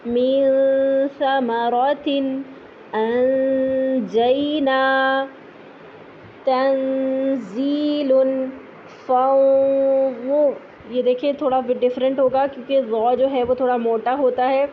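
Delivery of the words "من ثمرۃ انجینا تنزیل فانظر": long nasal ghunna on each noon; slow Quranic recitation. Shown as a demonstration. Teacher reciting examples for students.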